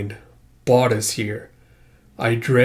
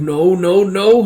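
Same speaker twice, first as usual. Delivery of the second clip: higher, louder with each "no"; rising dismay; 6 semitones higher, 5 dB louder, slower than usual. Acted. Character finding companions knocked out, mounting acted panic. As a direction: climb in alarm.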